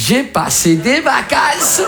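slow, high, stretched-out boast; hammed-up rapturous returning traveller; gushing holidaymaker. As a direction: draw out every syllable, climbing in pitch. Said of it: "quelqu'un qui est revenu d'Algérie qui m'a dit".

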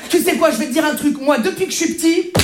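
normal talking voice

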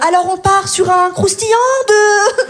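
playful imitation of a TV chef announcing the plate; Top Chef chef. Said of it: "elle fait comme dans Top Chef". (imitation moqueuse d'un chef de Top Chef)

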